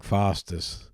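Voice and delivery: slow Australian-style FOSTER'S, "FAH-stuhs"; Australian accent. Retold or imitated. Teasing a drinker with beer-ad Foster's in Australian accent. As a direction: drop the R for an Aussie Foster's. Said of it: "hearing with the Australian accent".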